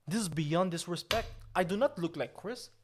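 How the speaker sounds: normal talking voice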